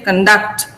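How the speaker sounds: conduct as cun-DUCT; second-syllable stress. Shown as a demonstration. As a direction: hit DUCT in conduct, first vowel reduced. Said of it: "I'm stressing duct".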